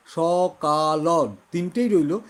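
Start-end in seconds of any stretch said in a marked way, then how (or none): 0.1-1.1 সকাল drawn out slowly with a held double k; slow doubled k. Shown as a demonstration. Teacher slowly shows the class a doubled-k সকাল. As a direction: draw out সকাল slowly, holding the ক twice. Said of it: "একটা কটা খালি দ্বিতীয়বার উচ্চারণ হলো".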